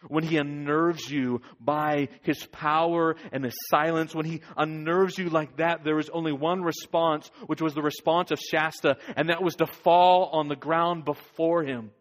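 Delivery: preacher urging the congregation to fall before God; preaching at full force. Real, not acted. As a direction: drive it home to the congregation.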